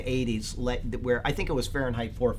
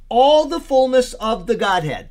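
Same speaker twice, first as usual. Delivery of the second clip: ALL THE FULLNESS pitched high and loud; "all the fullness of the Godhead" proclaimed forcefully; emphatic; 9 semitones higher, 8 dB louder than usual. Real, not acted. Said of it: "That's emphatic in the Greek".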